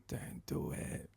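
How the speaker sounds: slow, voiceless whisper; whispered warning. Retold or imitated. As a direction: barely mouth it, as a secret warning. Julia silently warns him.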